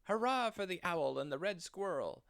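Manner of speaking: high, jubilant hurrah; cheering. Acted. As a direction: celebrate the owl and squirrel. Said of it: "ran around and cheered".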